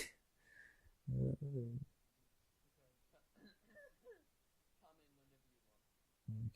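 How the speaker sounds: sheepish laugh; long laugh at himself. Real, not acted. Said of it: "I had briefly forgotten".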